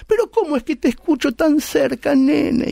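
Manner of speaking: a mother sensing something is wrong; suspicious old mother. Retold or imitated. Old mother suspicious that her son sounds so close.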